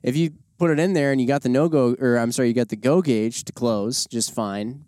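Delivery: ordinary talk, nothing marked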